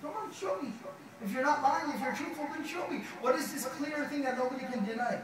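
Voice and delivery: high, challenging taunt; a scornful king daring the prophet; taunting pharaoh. Retold or imitated. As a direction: dare him to prove it. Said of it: "He says".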